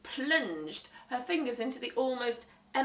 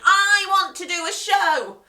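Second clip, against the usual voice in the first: put-on higher, louder insistent boy; whiny demand; 11 semitones higher, 15 dB louder than usual. Henry demanding to perform, loud whine.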